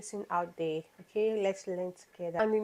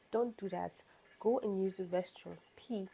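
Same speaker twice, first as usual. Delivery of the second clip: ordinary talk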